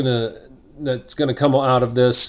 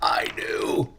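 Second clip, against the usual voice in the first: whisper; 8 dB quieter than usual. Pretend. Tiny answer to own question.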